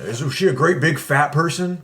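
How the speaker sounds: lightly voiced creepy villain; a hint of a movie creep; Buffalo Bill. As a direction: quote the killer in a put-on voice. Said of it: "I don't like that dude's voice".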